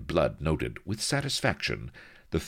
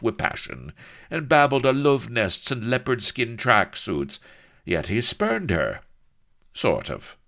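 louder, gleefully exaggerated telling that climbs higher; sly mischief-maker; 7 semitones higher, 6 dB louder than usual. A narrator's reading. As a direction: relish every scandalous detail. Blood stirring mischief.

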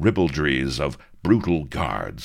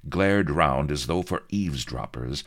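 plain reading voice

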